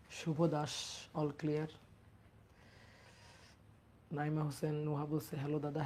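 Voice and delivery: low, soft, close to the mic; speaking softly. Real, not acted. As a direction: keep the voice down, barely above a murmur. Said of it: "আমি আস্তে আস্তে কথা বলছি তো".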